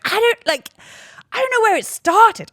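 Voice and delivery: high-pitched, emphatic mock disbelief; mock bewilderment. Someone reacting to a rumour they just heard, mock bewildered. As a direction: protest it high and loud, acting baffled.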